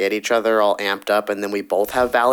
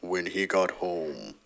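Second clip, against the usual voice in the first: low, quiet, slow deep voice; 5 semitones lower, 11 dB quieter, slower than usual. Retold or imitated. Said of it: "Are you trying to make your voice deeper".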